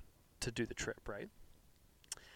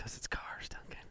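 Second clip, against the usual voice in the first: whispering; 7 dB quieter than usual. Acted. Hushed reveal of topic.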